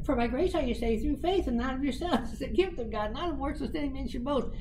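high, eerie quoted voice; heard voice. Retold or imitated. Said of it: "and I hear".

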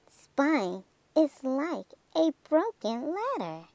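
usual speaking voice

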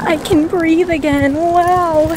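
loud relieved exhale of words; finally able to breathe, big relief. Real, not acted. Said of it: "I'm outside now".

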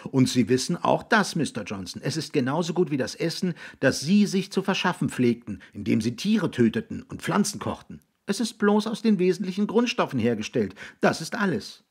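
put-on high, matter-of-fact boy's voice; arguing boy. Jiggs defending artificial food to William, insistent.

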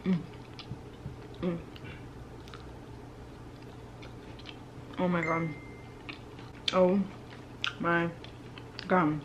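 talking while chewing pastry; muffled mm between bites; mouth full, chewing. Real, not acted. Seen 1: Bites pastry near 2.0-2.6, chews with closed mouth throughout.

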